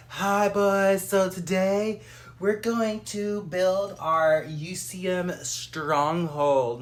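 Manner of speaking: high, loud put-on hostess voice; mock streamer intro. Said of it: "I was just like".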